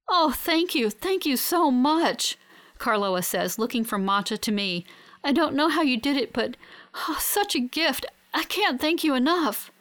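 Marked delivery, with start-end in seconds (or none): 0.1-2.4 high, heartfelt, gushing thanks; overwhelmed gratitude. A narrator's reading. Overwhelmed gratitude to her rescuers. 5.2-9.7 high-pitched, emotional thanks; overwhelmed gratitude. A narrator's reading. Overwhelmed gratitude to her rescuers.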